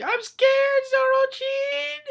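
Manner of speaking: very high, loud, drawn-out wail; hammed-up frightened character voice; scared girl character. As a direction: squeal it in mock fright. Acted Cammy voices fear.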